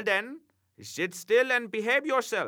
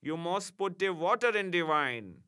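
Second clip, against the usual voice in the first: put-on soothing German-accented imp, slower; softened, consoling; 7 semitones higher than usual. A narrator's reading. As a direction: gently coax him to stop crying. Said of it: "much softened at my distress".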